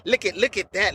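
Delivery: ordinary talk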